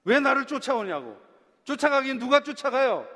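mock-angry shout of denial; angry retort. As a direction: fire back at the accusation. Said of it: "그래서 막 화를 내요".

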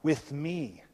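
Jesus speaking low and soft, quickened; a gentle, intimate voice for Jesus; voicing Jesus. Preacher voicing Jesus inviting the disciples, low and soft. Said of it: "He goes".

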